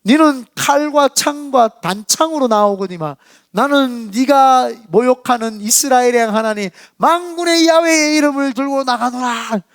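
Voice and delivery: high, slow, ringing battle challenge; bold declamation. Acted. David proudly declaring God's name, bold declamation. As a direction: proclaim it like David facing down an enemy, drawing out the end. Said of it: "말을 얼마나 잘하는지".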